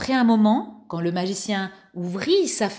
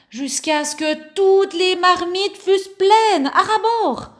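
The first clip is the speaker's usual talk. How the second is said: rising excitement; 9 semitones higher, 4 dB louder than usual. A narrator's reading. Narration builds up.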